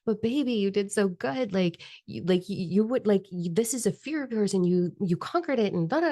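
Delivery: a hint of the encouraging partner; imitating partner. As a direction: mimic her cheerleader partner gently. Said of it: "my partner ... he's like".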